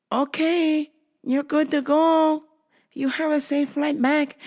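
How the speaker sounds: high, measured put-on agent voice; female agent impression. Agent sending the traveler off, imitated high voice.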